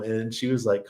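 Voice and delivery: usual speaking voice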